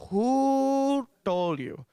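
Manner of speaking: very long, high WHO, held loud; hammed-up furious Indian mother's demand; mad mom escalating. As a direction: stretch WHO out high and slow. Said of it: "never seen her this mad before".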